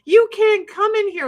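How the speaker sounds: mock blocking the door, yelling; defiant yell at agents. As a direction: bar the door and yell them off. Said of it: "I'm standing in front of the door like".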